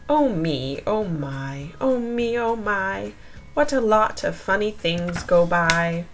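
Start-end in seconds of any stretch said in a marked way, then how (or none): none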